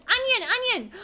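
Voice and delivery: high, loud excited cry; excited calling out. Real, not acted. Streamer recognising a game character, excited high calling. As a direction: call it out in high, delighted bursts. Spots onion knight in game.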